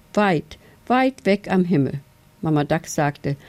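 ordinary delivery

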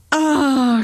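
high, loud long cry; falling scream. A narrator's reading. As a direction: scream while plummeting. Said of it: "schrie sie".

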